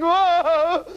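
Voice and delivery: high, loud mock wail; a hammed-up howl of grief; king's howl. King howling over his lost tree, mock wail. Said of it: "howled the king".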